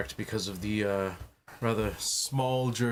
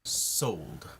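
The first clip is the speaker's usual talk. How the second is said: smug; 6 semitones lower, 3 dB quieter than usual. Acted. Cool dominant closing word.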